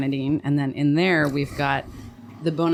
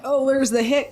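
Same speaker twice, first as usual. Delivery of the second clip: imitating a central Pennsylvania accent, pitched higher; a mocking line in a central Pennsylvania twang, higher and louder; 11 semitones higher, 4 dB louder than usual. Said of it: "Mayor of Easttown meets Pittsburgh, whatever that accent is".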